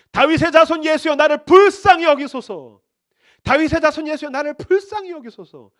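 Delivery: desperate beggar's loud plea; crying out. Acted. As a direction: beg at the top of your voice. Blind beggar crying out to Jesus.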